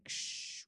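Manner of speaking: soft hissed ksh like surf breaking; whispered wave crash. Retold or imitated. Host imitating waves on the beach, soft hiss. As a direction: breathe out the sound of waves. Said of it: "cats just crashing again".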